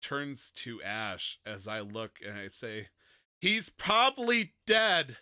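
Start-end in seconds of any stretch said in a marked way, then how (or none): 3.4-5.2 high, loud, drawn-out cry; panicked outburst. Acted. Character losing control on the porch, panicked. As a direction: blurt it out loud and high, slowly.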